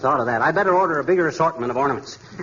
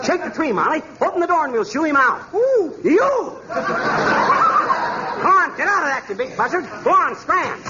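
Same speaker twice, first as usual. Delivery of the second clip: higher, urgent calls while chasing the bird; frantic chase calls; excited shouting. Acted. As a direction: shoo the owl out in a flurry. Chasing an owl out.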